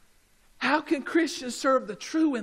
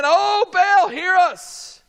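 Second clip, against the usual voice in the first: raised, higher pleading call; pleading cry to Baal; 8 semitones higher, 6 dB louder, slower than usual. Acted. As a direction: cry out to an idol begging to be heard. Prophets calling on Baal.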